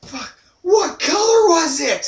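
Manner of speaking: high, loud, slow yell; mock frantic shout. Retelling the movie, Rocco screaming at the girlfriend. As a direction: bellow it in crazed rage. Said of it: "he's like".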